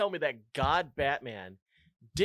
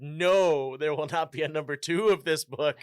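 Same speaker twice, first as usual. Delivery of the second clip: booming God voice; 9 dB louder than usual. Acted. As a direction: thunder it down like God. Voicing God forbidding a sequel.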